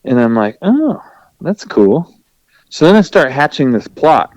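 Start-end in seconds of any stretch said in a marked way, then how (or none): 0.6-2.1 high "Oh" of discovery; light up at the idea; mild delighted realization; pleased surprise. Retold or imitated. Spots deicer, pleased.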